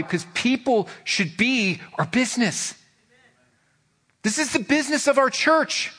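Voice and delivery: rising, urgent sermon voice; preaching. Acted.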